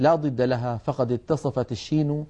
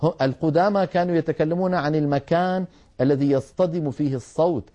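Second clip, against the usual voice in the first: usual speaking voice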